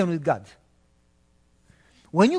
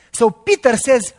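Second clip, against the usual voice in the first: loud; 3 semitones higher, 7 dB louder than usual. Acted. Preacher retelling loudly.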